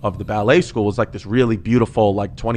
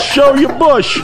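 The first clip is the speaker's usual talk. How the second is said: laughing, much higher, slow mock shout; retold yell; 13 semitones higher, 4 dB louder, much slower than usual. Son retells dad heckling the ballet instructor, laughing shout. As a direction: belt it out while cracking up. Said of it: "He yells".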